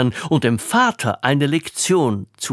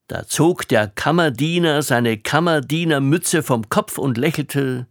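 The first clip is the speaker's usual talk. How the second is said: plain reading voice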